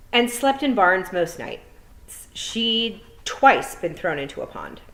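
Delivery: measured read-aloud storytelling voice; unhurried storybook narration with lively swells; story narration. A narrator's reading. Teacher reads novel aloud.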